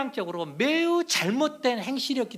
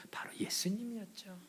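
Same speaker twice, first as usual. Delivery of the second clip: soft dramatic whisper; 2 semitones higher, 11 dB quieter than usual. Real, not acted. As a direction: drop to a whisper for the answer. Preacher whispers the reveal. (속삭이듯이)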